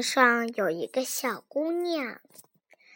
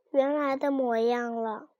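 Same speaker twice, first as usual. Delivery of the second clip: ordinary delivery, nothing marked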